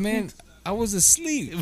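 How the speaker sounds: whiny protest. Retold or imitated. Protesting to teacher.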